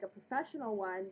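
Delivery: ordinary talk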